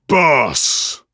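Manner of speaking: roar. Acted. Brute boss roaring at his cornered prey, loud savage roar. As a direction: bellow it out savagely. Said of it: "he roared".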